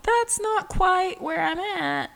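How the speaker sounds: high-pitched polite backing away; hesitant demurral. Retold or imitated. Podcaster distancing self from the extreme, high demurral. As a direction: lift the voice way up to demur.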